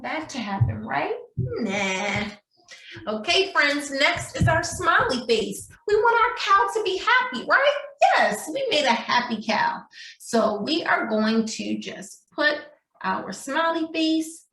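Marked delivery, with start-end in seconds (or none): none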